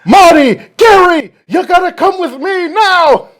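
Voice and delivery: high, loud urgent call; urgent shout. Pretend. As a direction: yell for them to get in the car now. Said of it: "And he's like".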